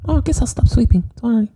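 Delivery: put-on high child falsetto, apologetic; meek little boy David. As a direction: give in as a sheepish little boy. Plays the exorcised boy David.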